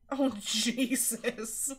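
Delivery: ordinary delivery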